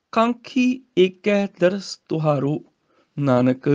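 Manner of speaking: ਨਾਨਕ ਤੇ ਰੁਕ ਕੇ; pause after Nanak. Shown as a demonstration. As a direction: halt on Nanak before going on. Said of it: "ਇੱਥੇ ਨਾਨਕ ਤੇ ਰੁਕਣਾ ਹੈ".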